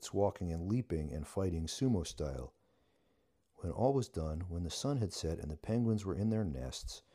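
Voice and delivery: ordinary delivery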